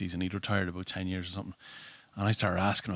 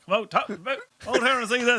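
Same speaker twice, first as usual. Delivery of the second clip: high, excited call to the back room; calling lads out; 13 semitones higher than usual. Retold or imitated. Desk guard calling colleagues out, high excited call. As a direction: call the lads out to see him. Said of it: "started calling lads out from behind".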